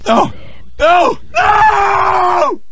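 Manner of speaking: high, loud cries of no; loud despairing no shouted high; frustrated shout. Real, not acted. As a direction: yell no as you lose. Killed in game, frustrated.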